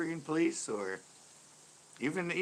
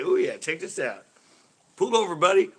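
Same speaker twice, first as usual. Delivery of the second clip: louder, higher show-off cop voice; cocky cop; 7 semitones higher, 12 dB louder than usual. Retold or imitated. Mocking a cop on the siren, cocky and loud. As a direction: play a cop showing off like a DJ. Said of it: "It's like they're a D J in the car".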